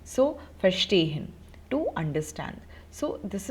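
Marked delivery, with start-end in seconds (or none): 0.6-1.3 verstehen with "ver" kept short, STEH stressed; unstressed prefix. Shown as a demonstration. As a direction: pass lightly over "ver" in verstehen, land on STEH. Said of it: "there is no emphasis on".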